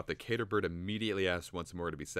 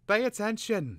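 much higher, louder sharp bird; hissing; 6 dB louder than usual. Acted. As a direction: snap at him. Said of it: "hissed the cater bird".

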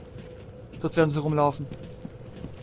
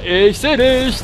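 slow teasing taunt; 5 semitones higher, 4 dB louder, much slower than usual. Pretend. Taunting hidden enemies.

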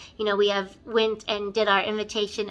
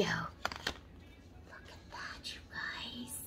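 awed whisper; 6 semitones lower, 20 dB quieter, much slower than usual. Real, not acted. Unboxing palette in awe.

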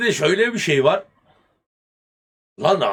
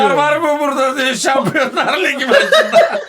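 laughing; 7 semitones higher, 4 dB louder than usual. Retold or imitated. Repeating the line while laughing.

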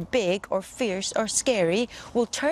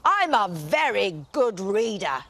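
grand dragon; 4 dB louder than usual. Acted. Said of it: "said the dragon grandly".

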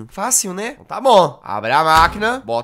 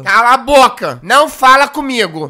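loud, higher mock yell; mock angry mother; 4 semitones higher, 8 dB louder than usual. Gamer voicing the mother yelling at her daughter, loud mock yell. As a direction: shout down the child as the angry mom.

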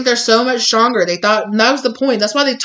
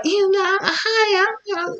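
much higher, airy sweet hello; sweet innocent hi; 13 semitones higher, much slower than usual. Retold or imitated. Client greeting the scared attacker, high sweet innocence. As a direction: greet them all innocent. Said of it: "you're just like".

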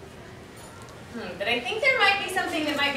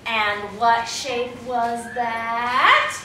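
very slow, stretched cue with rising end; cue line; 2 semitones lower, much slower than usual. Shown as a demonstration. Director demonstrating the cue for young actors, slow leading question. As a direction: draw it out, swooping up on THAT. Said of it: "somebody's gonna say".

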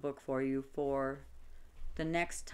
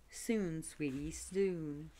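soothing; 2 semitones higher, much slower than usual. A narrator's reading. Boyfriend soothing Mary.

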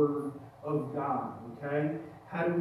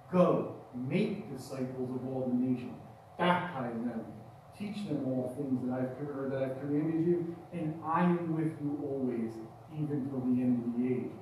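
scripture reading; 6 semitones lower, 4 dB quieter than usual. Acted. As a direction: let each phrase land with reverence. Rereads scripture passage.

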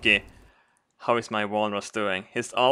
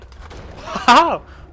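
high loud cackle; burst of laughter; 13 semitones higher, 6 dB louder than usual. Real, not acted. Player cracking up at flying enemy, high laugh. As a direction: explode into laughter.

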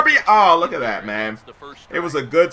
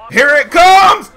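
high, loud, slow shout; real hype as the pitch comes in; excited shout; 12 semitones higher, 10 dB louder, slower than usual. Real, not acted. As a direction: brace for the big pitch, yelling. Big pitch, bases loaded.

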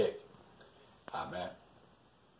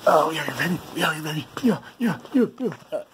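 excited whooping; 7 semitones higher than usual. Acted. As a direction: whoop it up jumping on the bed. Celebrating on the bed.

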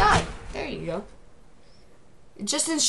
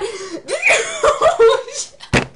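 laugh; 13 semitones higher, 4 dB louder than usual. Real, not acted. Laughs after losing the round.

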